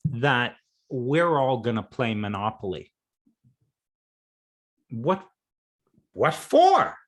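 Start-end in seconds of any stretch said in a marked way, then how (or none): 4.9-7.0 high, loud, disbelieving squeak on "what for"; a shocked squeal: "what, what for?"; incredulous what for. Retold or imitated. Voicing a doubting big person.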